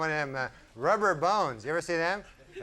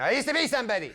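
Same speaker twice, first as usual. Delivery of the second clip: washed-up Doberman; 9 semitones higher, faster than usual. Acted. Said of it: "park bench with a Frisbee".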